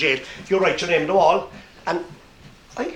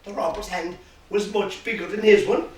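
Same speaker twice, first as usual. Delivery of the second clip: ordinary delivery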